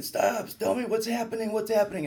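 high, loud desperate cry to be told what's happening; retold panicked scream. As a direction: yell it like a terrified patient in the trauma bay. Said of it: "I start screaming".

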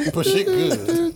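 high, slow laughing cheer. Acted. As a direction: squeal it through laughter.

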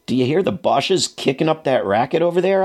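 mildly roused, rising query; animated question. A narrator's reading. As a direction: ask it with a touch of urgency, pitch climbing. Narrator acts own question to commander.

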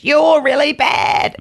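high, slow, loud jeer; mocking heckle shout. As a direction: belt it out like a heckler from the crowd. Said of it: "who said to me as I walked on stage".

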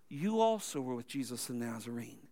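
low, soft, sneering "you also were with Jesus the Nazarene"; hushed contempt. Retold or imitated. Servant girl quietly sneering at Peter, contemptuous and hushed. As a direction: murmur the accusation with quiet scorn. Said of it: "a very contemptuous, uh, tone".

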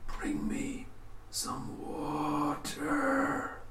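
slow, drawn-out whisper from a dry throat; raspy corpse whisper. Acted. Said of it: "the man kept whispering".

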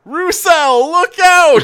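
very high, loud mock shout; yell from stands. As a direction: holler a warning from the crowd. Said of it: "someone in the stands will yell".

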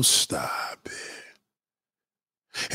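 whispered stop it. Acted. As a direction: breathe it out as a secret aside. Hushed aside to himself.

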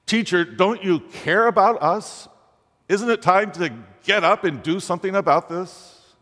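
high-pitched, urgent prayer to the teacher; crying out. Acted. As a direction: lift your voice in an anguished plea. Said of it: "we have to cry out".

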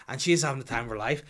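ordinary talk, nothing marked

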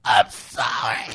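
slow apology crying with laughter; sorry through laughter. Retold or imitated. Dad voicing his sorry daughter while laughing. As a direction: squeeze it out while cracking up. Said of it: "she's like".

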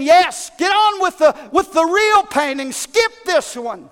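crowd agreeing. Acted. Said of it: "more voices consenting".

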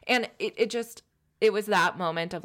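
normal talking voice

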